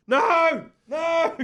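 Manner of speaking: high, loud cry of No, no; desperate fan's shout; anguished shout. Retold or imitated. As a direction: yell like the game is slipping away. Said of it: "we're like".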